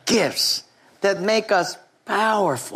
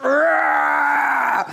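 mock flex roar; 7 semitones higher than usual. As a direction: roar like a bodybuilder posing. Muscle man flexes to camera.

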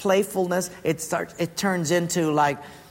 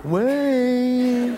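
Mike calling; 6 semitones higher than usual. Retold or imitated. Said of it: "So I finally opened".

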